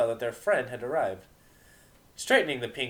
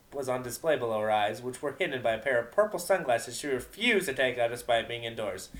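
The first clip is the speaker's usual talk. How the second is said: usual speaking voice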